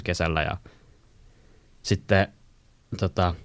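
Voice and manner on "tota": ordinary talk, nothing marked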